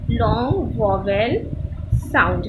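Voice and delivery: Long vowel sound said slowly with a pause before sound; slow and spaced out. Shown as a demonstration. Teacher slowly reads out the title to students. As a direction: spell the title out slowly for the class. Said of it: "एक था आपका long vowel sound वाला sentence".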